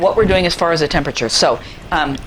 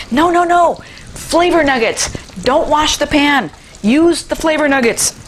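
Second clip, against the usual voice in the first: emotional outburst; 8 semitones higher, slower than usual. Real, not acted. Cook begging viewers not to wash the pan, emotional and high. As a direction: passionately beg them to keep the brown bits. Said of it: "I get emotional about flavor nuggets".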